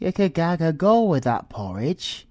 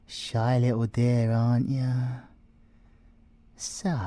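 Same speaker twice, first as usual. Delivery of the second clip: ordinary talk, nothing marked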